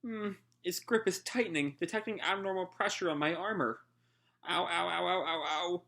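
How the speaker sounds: a robot-like knight reporting damage; mild mock pained ows; strained android voice. Homura squeezed by tentacle.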